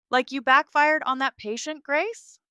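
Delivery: high, loud angry taunt; angry, cutting shout. A narrator's reading. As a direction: cut across the room with it. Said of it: "Dallas's voice cuts through the E R".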